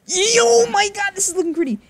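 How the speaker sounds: very high, loud whoop; excited yell. Real, not acted. Excited at his play.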